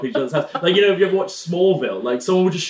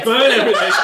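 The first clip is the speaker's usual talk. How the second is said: ordinary delivery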